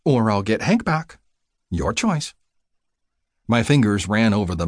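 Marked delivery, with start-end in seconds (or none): 0.0-2.3 higher-pitched playful threat; teasing. A narrator's reading. Nurse threatening to fetch Hank back, teasing. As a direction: dangle the threat lightly, pitched up. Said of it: "her voice became more teasing".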